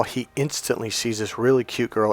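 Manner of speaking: ordinary talk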